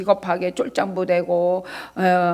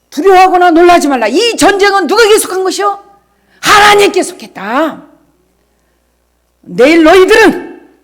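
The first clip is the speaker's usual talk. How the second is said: full-force preaching; 8 semitones higher, 10 dB louder than usual. Acted. Pastor proclaiming scripture to congregation, full-force preaching. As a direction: thunder God's word over the room.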